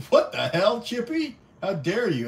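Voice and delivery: high, loud fake scolding; mock indignation. Real, not acted. Streamer to Chippy, mock-scolding him loudly. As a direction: scold him with hammed-up shock.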